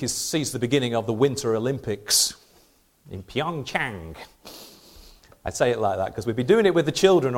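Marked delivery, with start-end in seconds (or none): 3.1-4.2 light Korean accent on Pyeongchang, aspirated p, nasal ang; South Korean accent. Shown as a demonstration. To the congregation, Pyeongchang in a Korean accent. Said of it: "that's my S-, South Korea accent".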